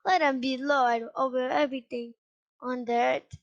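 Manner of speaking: ordinary delivery, nothing marked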